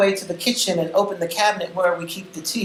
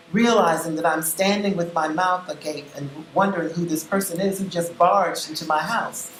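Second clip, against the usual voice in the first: usual speaking voice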